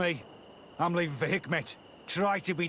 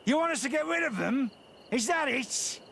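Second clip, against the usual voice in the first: fast, higher, pressing question; menacing threat; 7 semitones higher than usual. Acted. Boss threatens the servant about his children, menacing. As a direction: squeeze him with the threat.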